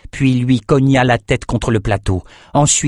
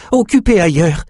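high, a bit louder repeat; nervous servant; 9 semitones higher, 4 dB louder than usual. A narrator's reading. Cook repeating his excuse to the king, high and insistent. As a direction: insist on the lie. Frightened slave lies.

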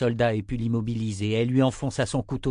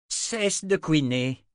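slow, higher, contemptuous rebuke; scornful order; 6 semitones higher, much slower than usual. Acted. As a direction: scold him like a whining dog. Sister scolds wounded man.

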